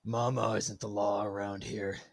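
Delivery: gruff, grisly lawman impression; Karl Urban. Imitating the film's hero claiming authority, gruff and grisly. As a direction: say it gruffly, evenly, as the law itself. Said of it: "in his gruff, grisly voice".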